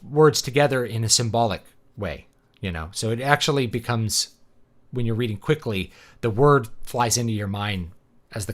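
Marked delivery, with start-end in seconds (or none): none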